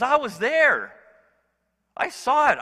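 excited eyewitness. Acted. Peter tells the church he saw it, excited and loud. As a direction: insist you saw it with your own eyes.

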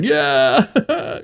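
high, drawn-out mock cheer; hammed-up high "yeah" of approval; men's cheer. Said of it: "all the men are like".

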